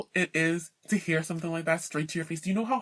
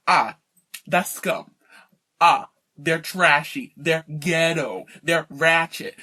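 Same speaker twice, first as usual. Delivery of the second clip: slow, lower and louder disdain; mock snobbish sneer; 5 semitones lower, 6 dB louder, slower than usual. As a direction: drag out the contempt as a snob. Mocking snobs sneering.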